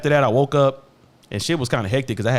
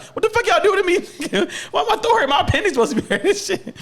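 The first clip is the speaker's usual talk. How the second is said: laughing disbelief. Retold or imitated. Patient waking sore, laughing high mock complaint. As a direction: retell your own shock while cracking up. Him reacting to throat pain.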